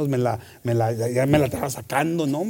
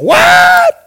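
shocked shout; 12 dB louder than usual. Acted. Told he owns a castle.

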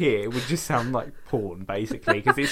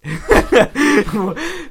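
loud high laugh; 13 semitones higher, 9 dB louder than usual. Real, not acted. Telling Jess about the missed play, bursting into laughter.

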